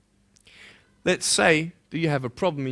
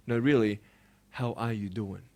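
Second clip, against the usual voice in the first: soft; 8 semitones lower, 7 dB quieter than usual. Shown as a demonstration. Gentle follow-up to a friend.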